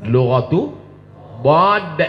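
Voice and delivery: "dhad" with a heavy emphatic Arabic d; the dhad letter. Shown as a demonstration. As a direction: push the heavy dhad in "dhad" forward. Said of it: "disebut dengan lughatul dhad".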